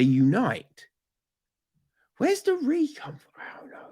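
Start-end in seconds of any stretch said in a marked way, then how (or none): 2.2-3.9 high whisper to yourself; whispered aside. Real, not acted. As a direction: puzzle over it in a whisper.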